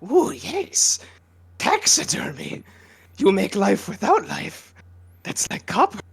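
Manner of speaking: high, raspy whispered creature croon; Gollum voice. Acted. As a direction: hunch over and hiss like Gollum. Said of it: "hunched over my chair l-, like Gollum".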